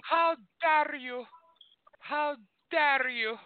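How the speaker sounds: high, slow, outraged squeal; Greta Thunberg impression. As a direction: shriek it like the meme girl. Mocking meme girl line.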